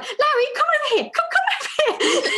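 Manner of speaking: high, giggling call to the son; laughing dad. Retold or imitated. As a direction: laugh through calling Larry over. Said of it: "And Matt was like".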